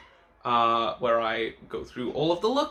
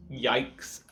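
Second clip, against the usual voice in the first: usual speaking voice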